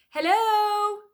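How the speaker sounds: loud, high, long hello; shouting. Acted. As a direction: call down from the sky. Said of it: "Leonardo shouted".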